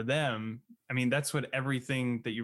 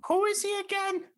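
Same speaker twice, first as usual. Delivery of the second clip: high mocking voice. Mocking bored student voice.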